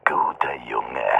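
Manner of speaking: low, slow condescending praise; mocking menace. A narrator's reading. Attacker mocks the sobbing boy, low slow menace. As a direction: praise him like a dog, coldly.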